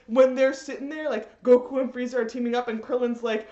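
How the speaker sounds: usual speaking voice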